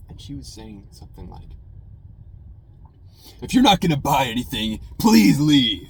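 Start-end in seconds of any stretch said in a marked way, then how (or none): 3.4-5.9 loud yell at a loiterer; cashier yelling. Retold or imitated. Cashier yelling at a customer to leave. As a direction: shout him out of the store. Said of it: "The cashier was yelling at someone".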